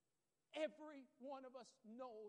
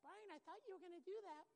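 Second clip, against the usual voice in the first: little voice; 6 semitones higher, 5 dB quieter than usual. Retold or imitated. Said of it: "you hear a little voice".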